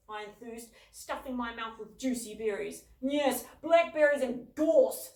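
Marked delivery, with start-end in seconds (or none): 3.0-5.1 slow, loud, heavy gloom; even gloomier. A narrator's reading. Father gloomily listing the weeds, slow and heavy. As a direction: drag it out in despair. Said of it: "said Dad even more gloomily".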